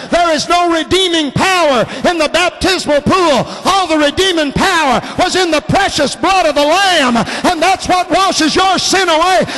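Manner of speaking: fervent revival preaching; preaching at full force. Acted. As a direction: preach it hard to the congregation.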